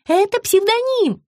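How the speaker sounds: high sugary explanation; high sugary woman. A narrator's reading. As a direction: sweetly explain. Margarita's voice.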